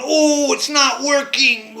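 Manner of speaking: high, slow, dismayed cry of a painted figure. Retold or imitated. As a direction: let the little man wail that it failed. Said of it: "is going".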